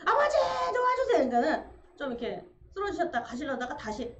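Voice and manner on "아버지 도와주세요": high, loud plea for help; cry for help. Retold or imitated. Son begging for help in panic, high loud plea. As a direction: cry out urgently.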